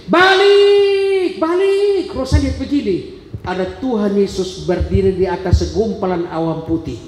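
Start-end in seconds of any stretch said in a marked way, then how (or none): 0.1-2.1 high, loud command "Balik"; retold shout. A voice shouting at his spirit to return, high and loud. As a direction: call it out from far away, much higher. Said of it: "berteriak begini".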